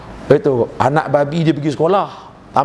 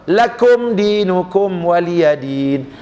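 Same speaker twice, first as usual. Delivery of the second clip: Quran recitation; much slower than usual. Acted.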